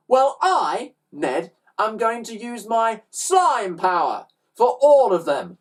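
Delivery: high, loud heroic declaration; announcing boy. A narrator's reading. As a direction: proclaim it as a defiant child. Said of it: "announced Ned".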